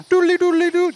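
short, high doodly spell sound; sing-song magic noise. Acted. Leprechaun turning a player into a gnome, high sing-song noise. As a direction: chant a silly high incantation.

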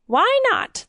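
high, sharp cry of disbelief. A narrator's reading. King crying out at his servant, high. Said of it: "the king cried".

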